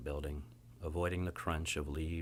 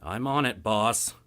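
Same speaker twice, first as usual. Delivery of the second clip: grumble; 4 semitones higher, 6 dB louder than usual. Acted. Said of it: "I grumble".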